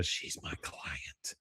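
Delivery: breathy secret whisper, slow. Retold or imitated. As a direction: lean in and whisper it. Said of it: "He's whispering that to Connie".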